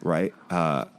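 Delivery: ordinary talk, nothing marked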